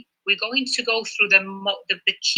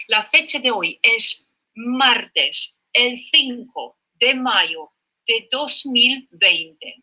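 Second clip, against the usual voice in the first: slow dictation; 3 semitones higher, much slower than usual. Shown as a demonstration. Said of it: "dictating the date".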